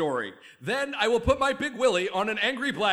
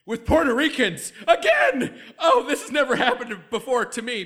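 panicked laughing; 5 semitones higher, 8 dB louder than usual. Acted. Slip again, panicked laughter.

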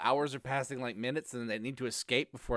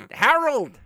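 high, loud villain bellow; 11 semitones higher, 8 dB louder than usual. Acted. Villain testing whether the name can be bellowed, bellowing. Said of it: "I can't bellow Harold".

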